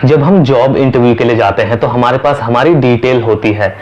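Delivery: ordinary talk, nothing marked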